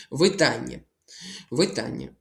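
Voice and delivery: «Видання» stressed on ДА; stress on second syllable. Shown as a demonstration. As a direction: lean on the middle syllable of «Видання». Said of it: "Наголос тут падає на ані". («Видання» з наголосом на «да»)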